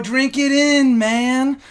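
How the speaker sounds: Jericho catchphrase. Retold or imitated. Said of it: "Gift of Jericho".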